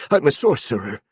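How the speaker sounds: high, hurried, fearful reply; frightened answer. A narrator's reading. Zeno hurries to answer the goblin, frightened. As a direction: answer fast, scared.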